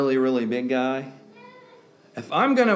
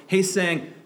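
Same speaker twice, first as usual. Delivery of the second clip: ordinary talk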